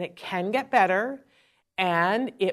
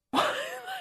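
short amused laugh; 5 dB quieter than usual. Real, not acted. Laughs at memory.

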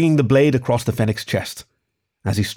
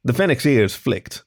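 usual speaking voice

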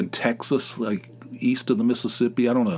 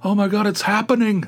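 mocking excited fans; 9 semitones higher than usual. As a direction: parody breathless fans going over the top. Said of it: "there's like a".

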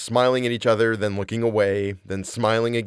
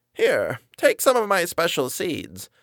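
put-on high, sweet old lady voice; an old woman's high, quavering apology; 7 semitones higher, slower than usual. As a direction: play a frail, kindly granny. Old lady offers seeds.

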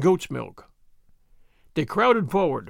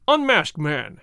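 usual speaking voice

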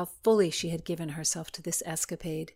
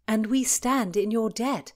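choked; 5 semitones higher, 6 dB louder than usual. Acted. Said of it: "Philomena choked".

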